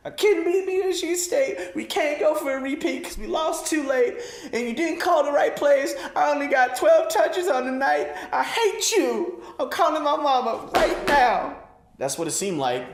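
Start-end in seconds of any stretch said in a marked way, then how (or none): 0.1-11.6 high, whiny fake sob of a sulking star; mock crying athlete. Star running back sobbing to the coaches, high whiny mock cry. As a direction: wail it like a spoiled player crying to the press. Continued mock whining rant.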